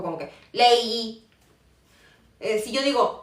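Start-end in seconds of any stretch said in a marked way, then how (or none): 0.5-1.3 "lei" slowly drawn out; prolonged syllable. Shown as a demonstration. Teacher showing how the word lengthens, long slow "lei". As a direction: stretch the glide in "lei". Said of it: "como que se prolonga un poco".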